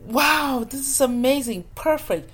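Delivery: the couple overjoyed at finding her; delighted wow. Retold or imitated. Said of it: "and they were like".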